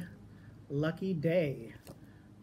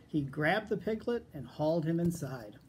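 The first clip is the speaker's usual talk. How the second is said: plain reading voice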